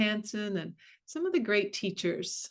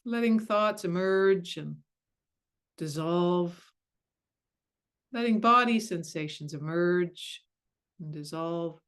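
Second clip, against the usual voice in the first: guided meditation. Acted. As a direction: ease them gently into stillness.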